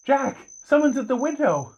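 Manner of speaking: high, soft voice of a startled woman; alarmed woman. Acted. Startled woman spots face at window.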